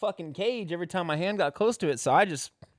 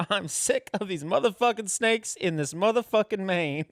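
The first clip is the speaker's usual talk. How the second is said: hammed-up exasperated line, pitched high; mock movie outburst; 6 semitones higher than usual. Parodying a film line for a friend, hammed-up exasperation. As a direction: play it as an overblown action-film outburst.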